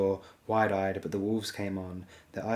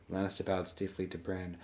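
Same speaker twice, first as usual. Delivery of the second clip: usual speaking voice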